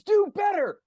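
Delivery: very high, loud yell; sideline yell. Acted. Coach yelling at a player from the sidelines, loud and high. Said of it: "It's like yelling on the sidelines".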